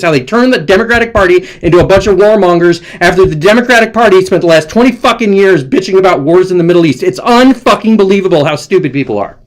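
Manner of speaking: high-pitched, exasperated real tirade; venting rant. Real, not acted. Host venting about Democrats turned warmongers, real exasperation. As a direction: let it all out, incredulous and relentless. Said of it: "I had to get that out".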